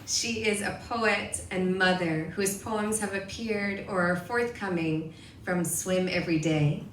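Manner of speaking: usual speaking voice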